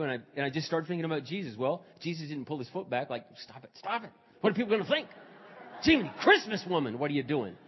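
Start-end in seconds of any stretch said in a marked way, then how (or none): 3.4-7.6 mock embarrassed scolding. Imagined embarrassed Jesus scolding the woman.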